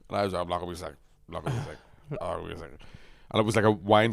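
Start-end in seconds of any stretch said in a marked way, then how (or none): 0.4-2.7 low, soft drunken mumble, imitated; mumbled denial. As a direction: mumble it over and over under your breath. Retold drunk self reassuring driver.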